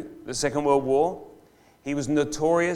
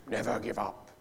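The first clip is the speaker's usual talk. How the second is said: a soft, lightly British Churchill line; attempted British accent; 3 semitones lower, 6 dB quieter than usual. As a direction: try the British accent, quietly. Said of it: "Wish I could say it with that British accent".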